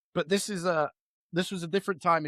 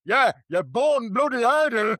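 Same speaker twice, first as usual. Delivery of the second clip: slow, louder grandad jibe; imitating grandad Pete; 4 semitones higher, 5 dB louder, slower than usual. Mocking Pete's scolding voice for his grandson. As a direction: put on Pete's old scolding voice. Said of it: "the first thing he'd say is".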